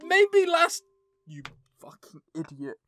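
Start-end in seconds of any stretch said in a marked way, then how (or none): 1.3-2.8 muttered curse. Real, not acted. Fuming at game, first muttered.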